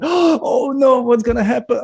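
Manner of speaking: hammed-up mother screaming in fright; panicked mother. Mother watches the meteor hit her kid, high mock shriek. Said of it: "and mother was like".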